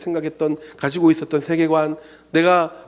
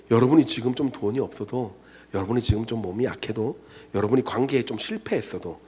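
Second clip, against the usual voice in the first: ordinary talk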